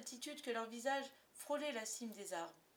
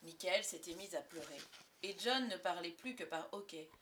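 ordinary delivery

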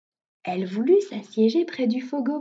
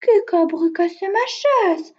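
high, loud whining little bear; whimpering complaint, pitched high and loud; Petit Ours; 8 semitones higher, 5 dB louder than usual. A narrator's reading. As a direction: wail like a tearful bear cub. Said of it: "pleurchigna Petit Ours".

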